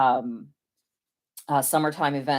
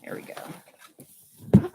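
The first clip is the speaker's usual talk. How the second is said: whispered; much slower than usual. Real, not acted. Quietly finishing the balloon.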